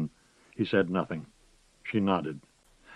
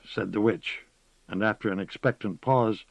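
ordinary delivery

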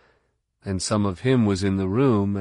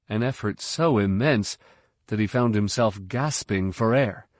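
usual speaking voice

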